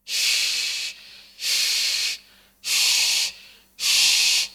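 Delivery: long breathy shh sound; airy shin hiss. Shown as a demonstration. Teacher demonstrating the airy shin sound. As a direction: push lots of air through the shh. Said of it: "benar-benar banyak dalam mulut".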